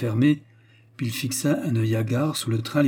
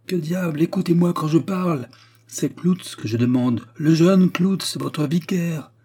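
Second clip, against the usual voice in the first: raised voice, high and insistent; exasperated demand; 6 semitones higher, 5 dB louder than usual. A narrator's reading. Steward cutting through the pastor's lamenting, angrily exasperated. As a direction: insist crossly on being heard.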